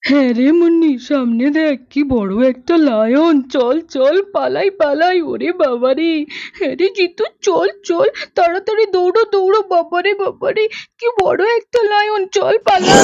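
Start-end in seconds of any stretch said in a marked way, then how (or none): 3.9-13.0 put-on boy running from a huge lion, near hysterical; terrified fleeing. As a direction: scream while running from the beast. Children flee from lion.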